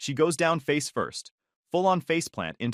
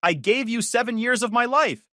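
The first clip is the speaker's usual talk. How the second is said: high, loud, rising fury; escalating drunk yell; louder yelling; 11 semitones higher, 4 dB louder than usual. A narrator's reading. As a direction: keep shouting and building. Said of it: "getting louder".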